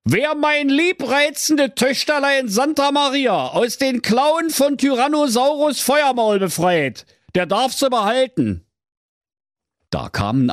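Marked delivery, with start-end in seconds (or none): 0.0-8.6 royal proclamation. Acted. Herald proclaiming the king's decree, high and grand. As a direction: announce it like a pompous herald.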